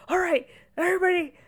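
high, fast, hammed-up rallying call; rally everyone like a coach; imitating take-charge husband.

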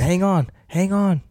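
slow high urgent call, hang on; mock urgent call to hold tight. Driver calling to his wife as the car flies, urgent. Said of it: "I kept saying".

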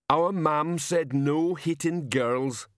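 big simple man reciting mother's rule; rumbling giant. A narrator's reading. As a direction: drag each word out doggedly. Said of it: "he rumbled".

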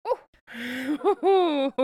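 high, loud ooh into laughter; whooping, laughing ooh; reacting to gore. Real, not acted. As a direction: hoot at how brutal it is. Reacting to brutal trailer.

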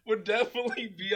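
high, loud burst of laughter; laughing. Real, not acted. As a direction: laugh it out loudly. Laughing at hated character.

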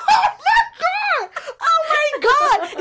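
very high, loud, drawn-out cry; exasperated shriek. Acted. Sister hears the galling reply, high exasperated shriek. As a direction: wail it slowly in laughing outrage.

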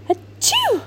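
squeaky little dragon sneeze; high sneeze. Acted.

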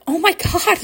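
high-pitched mock astonishment; retold shock. Winner hearing the amount, high retold shock. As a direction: exclaim it high, reliving the shock.

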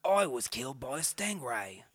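slow, broad mock Australian; Steve Irwin. Host introduces himself as dead naturalist, drawn-out Australian accent. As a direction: stretch out a hammy Australian accent. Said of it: "I'm Steve Irwin".

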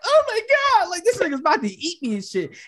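high, hammed-up childlike terror; mock crying panic. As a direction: play the scared kid, half laughing. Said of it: "crying and shit".

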